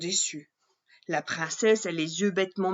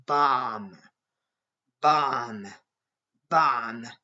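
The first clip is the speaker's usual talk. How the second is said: low, heavy booming "Bam!"; deep thudding footsteps; dragon footsteps; 8 semitones lower, 3 dB louder, much slower than usual. Acted. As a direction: stomp each one like a giant step. Dragon footsteps shake ground.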